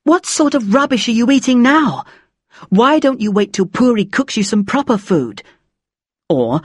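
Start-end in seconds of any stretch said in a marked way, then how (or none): 0.0-5.4 high-pitched scolding mother; nagging mum. A narrator's reading. Mum after her child about meals, nagging. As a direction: voice a mum's exasperated complaint. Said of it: "she'd be after me about my meals".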